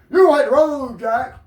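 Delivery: sudden loud high yell "You hit the road"; yelled order. Real, not acted. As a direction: bark the man out the door, loud and high. Throwing slackers out of the club.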